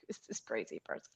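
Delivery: low, hushed thinking-to-himself voice; dropped low and soft; muttered aside. Retold or imitated. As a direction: mutter it under your breath as an imagined thought. Agent's imagined private thought.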